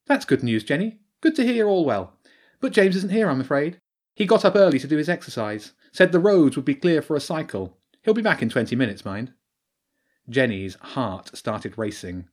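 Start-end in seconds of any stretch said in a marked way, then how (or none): 0.1-9.3 mum on phone. A narrator's reading.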